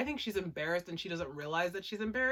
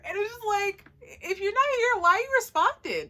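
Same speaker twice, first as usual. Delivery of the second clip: amused; 12 semitones higher, 6 dB louder than usual. Real, not acted. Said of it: "laughing comment".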